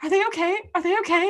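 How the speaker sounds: high, loud worried squeal; anxious fretting. Acted. Listener acts out her anxious reaction.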